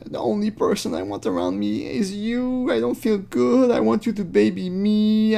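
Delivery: a hint of a babyish lover cooing; mock sappy lover. Mocking Lauren's romantic messages.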